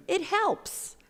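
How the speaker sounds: high, loud playful it helps; mock-triumphant. Shown as a demonstration. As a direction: announce it like a triumph. Playful emphasis on small deed.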